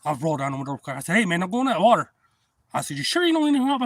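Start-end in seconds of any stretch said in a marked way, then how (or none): 1.2-2.1 re-enacting a raised-voice warning to a stranger; retold shouted warning. Warns stranger about alligators in water. 3.0-3.8 high, loud call offering help; retold calling out. Calls offer to man in water.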